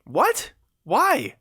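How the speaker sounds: high-pitched, incredulous questions; baffled surprise. Acted. Rescuer told "I hate you", high and baffled. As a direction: shoot the pitch right up in disbelief. Baffled reply to freed captive.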